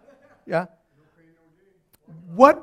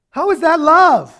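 high, loud, slow challenge; shouting a rhetorical question; 11 semitones higher, 13 dB louder, slower than usual. Real, not acted. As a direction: fling the question at them. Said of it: "preacher shouting question".

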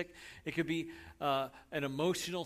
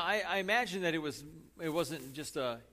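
usual speaking voice

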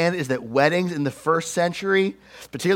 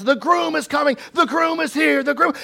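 excited crier shouting the news; announcing the groom; 9 semitones higher than usual. Retold or imitated. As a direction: herald the groom's arrival to everyone. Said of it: "people would announce".

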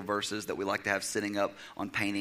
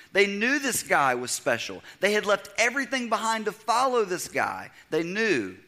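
preaching, pitch climbing higher and louder; rising preaching; 7 semitones higher, 4 dB louder than usual. Acted. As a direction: build the sermon to a fervent peak. Preacher at full force.

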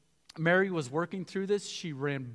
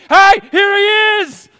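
high, loud, slow proclaiming call; Anna and Simeon announcing the baby to everyone; joyful shout; 22 dB louder, slower than usual. Pretend. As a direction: shout it to the whole temple.

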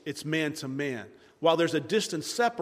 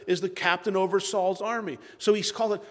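ordinary talk

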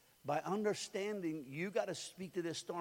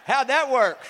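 loud, higher laughing question; laughing taunt; 6 semitones higher, 15 dB louder than usual. Real, not acted. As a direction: laugh through the jab. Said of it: "mocking the husband's failure".

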